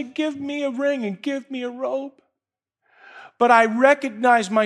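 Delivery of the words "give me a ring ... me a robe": higher entitled tone; mock demanding. Believer demanding gifts, mock entitled and higher. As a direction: sound presumptuous. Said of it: "adopt the language of the Father and say".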